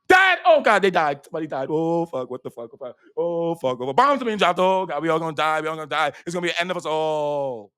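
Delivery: hammed-up panicked outcry, loud bursts sinking into moans; exaggerated doom-laden wailing; mock panic. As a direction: imitate someone freaking out over a death. Acts out people panicking over death.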